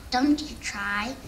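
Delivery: ordinary delivery, nothing marked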